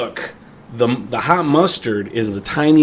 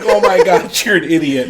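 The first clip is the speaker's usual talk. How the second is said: high, cracking up as you say it; laughing disbelief; 7 semitones higher, 4 dB louder than usual. Retold or imitated. Laughing at his friend's mustard disaster, amused disbelief. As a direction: scold a pal through laughter.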